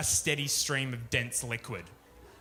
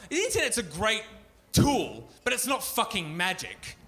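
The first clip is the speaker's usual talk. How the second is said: higher, rising angry rant; mock rant; 6 semitones higher than usual. Comic ranting at the eHarmony ad guy, mock anger. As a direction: tear into him, half-joking.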